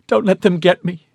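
high, tearful, desperate plea; weeping. A narrator's reading. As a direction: sob out the plea. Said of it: "she wept".

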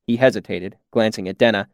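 plain reading voice

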